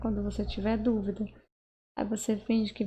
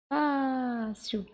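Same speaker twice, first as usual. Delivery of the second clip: "fácil" with a long, dragged "fá"; stretched stressed syllable; 2 semitones higher than usual. Shown as a demonstration. Teacher showing the tonic syllable, "fá" drawn out in "fácil". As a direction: hold the "fá" of "fácil" as if calling it out.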